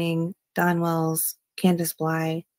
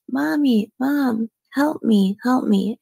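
high-pitched mock yell, no real volume; retold child's yelling; 5 semitones higher, slower than usual. Reading out a child's cries for help on a 911 call, mild imitated yell. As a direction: imitate a child calling out, pitched up but restrained. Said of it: "Summer can be heard yelling".

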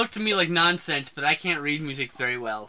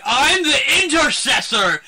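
high, loud hammed-up hero shout; imitating a grand battle cry; mock heroic proclamation; 8 semitones higher, 8 dB louder than usual. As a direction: announce yourself like an action-movie saviour. Acts out hero's big reveal.

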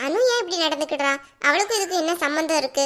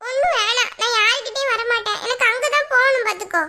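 put-on slightly raised, sulky kid voice; stubborn child; 7 semitones higher, 3 dB louder than usual. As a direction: refuse like a small child digging in. Child figure refusing grandmother.

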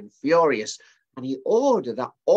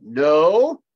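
kingly refusal; 4 semitones higher, 10 dB louder than usual. Acted. King refuses the astrologers.

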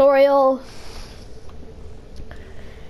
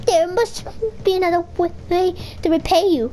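high-pitched stammering plea; 6 semitones higher than usual. A narrator's reading. Frightened brother pleading with the devil, stammering. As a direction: stumble on the first word, pleading up high.

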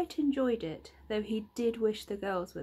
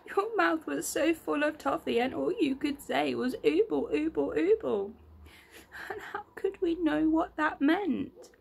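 put-on upset child; 5 semitones higher, 4 dB louder than usual. Franny's tearful outburst continues.

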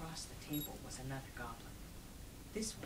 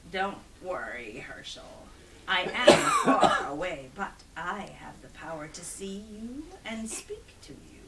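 ominous, unhurried villain; menacing goblin king; 6 dB louder than usual. Acted.

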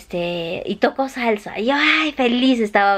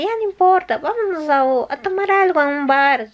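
lightly voiced buddy, pitched higher, coaxing; friend's eager invitation; 8 semitones higher than usual. Friend Ángel urging Elena to go for drinks, said higher and coaxing. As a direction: play the pal talking her into a drink.

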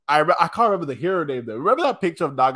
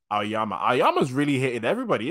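normal talking voice

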